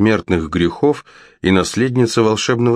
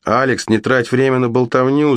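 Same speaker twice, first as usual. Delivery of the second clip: higher, pressing call; urgent shout; 6 semitones higher than usual. Acted. Brother shouts at his sister to act now. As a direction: hurry her with a shout. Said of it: "закричал ей брат".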